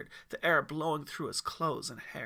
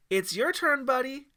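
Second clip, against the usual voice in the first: high, loud, friendly call; female guide voice; 10 semitones higher, 9 dB louder than usual. Acted. Guide calling the boy to the zip line, friendly. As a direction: call him up cheerfully. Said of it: "said the female guide".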